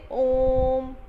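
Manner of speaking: ओम held long, very slow; long drawn om. Shown as a demonstration. Teacher to pupils, showing ओम drawn out long. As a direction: stretch ओम out like a chant. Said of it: "जब हम ओम बोलते हैं तो pronunciation काफ़ी लंबी चली जाती".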